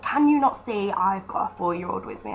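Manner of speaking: ordinary delivery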